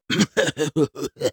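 a hammed-up, put-on cough for show; theatrical pretend cough; exaggerated fake cough. Shown as a demonstration. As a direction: overdo a fake cough like someone faking illness. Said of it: "I'm just doing that for dramatic effect".

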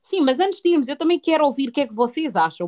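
loud, high, eager cut-in; eager interruption. Real, not acted. Co-host jumping in loudly before moving on, eager. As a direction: jump in before the topic moves on.

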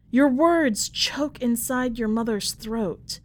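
ordinary delivery

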